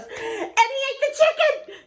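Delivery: high, slow giggling punchline; laughing friend. Retold or imitated. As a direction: blurt the punchline through laughter. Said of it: "And then your friend goes".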